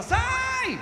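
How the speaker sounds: high yelled get out of the way; a sudden high shout of sai; mock shout. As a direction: bark it like clearing the road. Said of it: "a gente fala".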